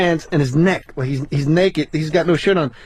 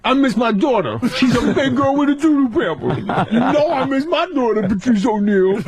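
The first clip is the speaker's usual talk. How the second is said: high rambling voice through laughter; high and dreamy, laughing; Tracy impression; 5 semitones higher than usual. As Tracy rambling to Patrice, high and laughing.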